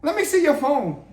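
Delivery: a hammed-up jealous wife snapping at him; mock angry demand. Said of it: "so she say".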